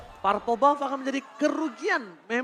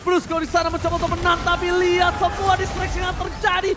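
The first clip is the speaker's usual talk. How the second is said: loud, fast, higher hype casting; excited play-by-play; 6 semitones higher, 6 dB louder than usual. Acted. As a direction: call the teamfight at full energy. [name] casts a teamfight live.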